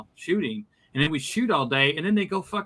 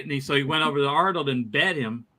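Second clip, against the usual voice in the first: ordinary talk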